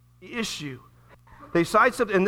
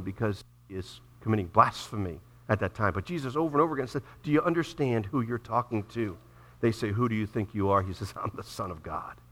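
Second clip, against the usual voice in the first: much lower and softer, almost confiding; hushed; 9 semitones lower, 8 dB quieter than usual. Acted. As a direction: draw them in with a hush. Sermon drops to hushed intensity.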